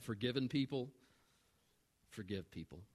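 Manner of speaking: very soft and low, with a weighty pause; grave, quiet statement; hushed key line. Real, not acted. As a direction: drop almost to a murmur for the main point. Key line first said softly, then repeated.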